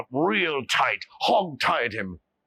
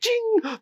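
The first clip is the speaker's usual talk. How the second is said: high bright chime sound; a sharp magical ping; mouth-made vanishing chime; magic ting. Acted.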